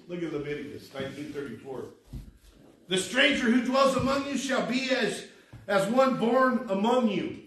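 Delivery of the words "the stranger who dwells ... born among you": raised, louder scripture preaching; forceful preaching. Real, not acted. Preacher urging the congregation to love strangers, emphatic preaching. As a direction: drive the verse home from the pulpit.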